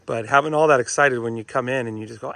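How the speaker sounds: normal talking voice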